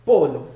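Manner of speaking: "Polo" in two even beats. Shown as a demonstration. Teacher showing students two beats, "Polo" in two beats. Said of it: "también dos".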